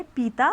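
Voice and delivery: पीता with a drawn-out long ई; wrong long vowel. Shown as a demonstration. Teacher showing the wrong way: पीता stretched long. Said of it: "नहीं बोलेंगे".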